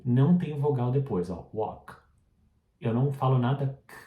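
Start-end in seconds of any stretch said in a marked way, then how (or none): 1.5-1.9 walk, stopping dead on the k; no vowel after k. Shown as a demonstration. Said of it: "pare no K e deixe o K bem sutil".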